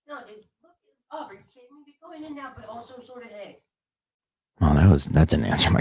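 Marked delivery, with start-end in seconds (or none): 0.1-3.6 very high, very soft squeak, fast; tiny squeaky voice. Pretend. Reading viewers' answers aloud in a tiny squeak.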